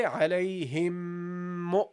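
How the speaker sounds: "Alayhim mu" slow, the m held into mu; slow nasal link. Shown as a demonstration. Teacher links the words for students, slow. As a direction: hum the m into "mu". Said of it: "So you say".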